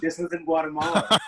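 amused confirmation with a laugh. Real, not acted.

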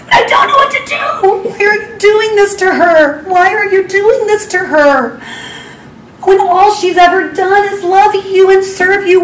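pleading high and strained, near tears; desperate tearful prayer. Retold or imitated. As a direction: cry out to God, lost and begging. Desperate prayer alone in closet.

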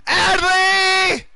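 screamed very high and loud, voice cracking; cracking shout. Real, not acted. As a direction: scream his name until the voice breaks. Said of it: "We're not gonna talk about the voice crack".

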